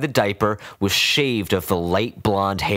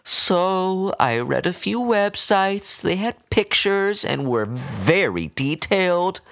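high, slow voice of a fussy pet owner scolding; doting old woman; 12 semitones higher than usual. A narrator's reading.

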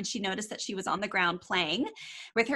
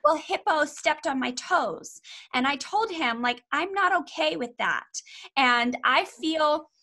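put-on slow, higher little-girl voice, earnest; sweet three-year-old voice; 4 semitones higher, slower than usual. Toddler telling her toy Hippo how she feels, slow and sweet. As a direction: take your time like a small child stating her feelings. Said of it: "in this sweet little three-year-old voice".

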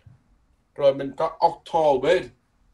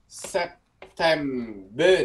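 very slow, separated "Sep-tem-ber"; syllable by syllable; 4 dB quieter, much slower than usual. Shown as a demonstration. Teacher breaks the month down for students, very slow syllables. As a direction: sound out "September" one syllable at a time.

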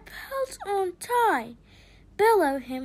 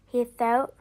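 ordinary delivery, nothing marked

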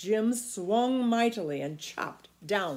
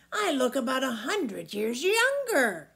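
delighted giant; 5 semitones higher, 5 dB louder than usual. A narrator's reading.

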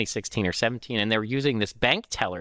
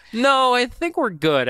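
much higher and louder, slow; drawn-out no thanks, backing away; mock polite refusal; 13 semitones higher, 6 dB louder, slower than usual. Employee turns down the job, slow and high.

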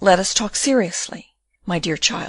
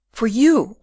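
"you" leaned on and raised in pitch; stress on you; 4 semitones higher, 4 dB louder than usual. A narrator's reading. Girl clarifying whom she would help, stress on "you". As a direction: put the weight on "you", higher, then falling.

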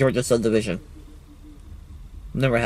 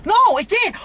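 excited. Real, not acted. Railfan finding his recording worked, excited. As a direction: shout it out, overjoyed.

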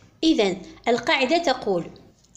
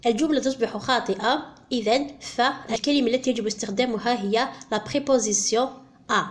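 ordinary delivery

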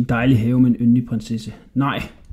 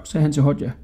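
plain reading voice

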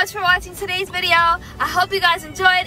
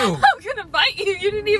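words through a giggle; giggly teasing threat; laughing; 5 semitones higher than usual. Real, not acted. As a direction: laugh while threatening playfully. Giggling during playful fight.

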